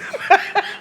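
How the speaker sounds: high airy chuckle; breathy laugh. Real, not acted. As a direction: laugh under your breath. Guest laughs after answer.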